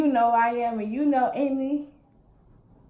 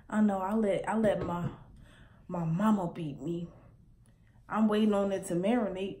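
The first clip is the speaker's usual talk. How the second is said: usual speaking voice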